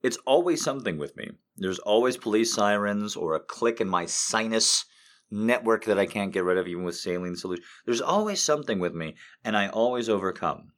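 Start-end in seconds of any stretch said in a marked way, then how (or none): none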